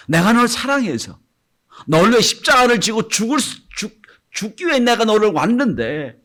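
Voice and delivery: loud high heartfelt appeal; pleading cry. Acted. Jesus pleads with his disciples, loud and high. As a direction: plead with them out of love. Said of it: "물으세요".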